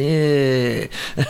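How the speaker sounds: long drawn-out "Yeah"; stalling for thought. Retold or imitated. Admitting a habit of stalling for words, drawn-out. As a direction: hold "Yeah" while searching for the next thought. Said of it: "I'm trying to think of what to say".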